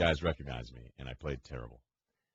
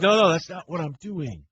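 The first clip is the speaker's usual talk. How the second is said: mock protest; 11 semitones higher, 8 dB louder than usual.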